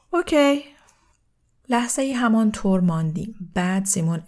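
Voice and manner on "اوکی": put-on high, louder kid's okay; lightly voiced child. A narrator's reading. Child agreeing to the deal, lightly voiced high and loud. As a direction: pipe up like a keen youngster. Child guest accepts and runs off.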